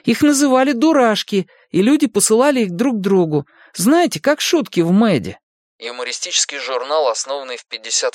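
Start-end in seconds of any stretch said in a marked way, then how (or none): 0.0-5.4 put-on much higher-pitched boy's voice; eager boy Mike. A narrator's reading. Mike shows his friends an old picture, high boyish explaining.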